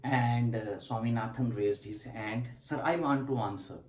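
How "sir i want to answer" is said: ordinary talk, nothing marked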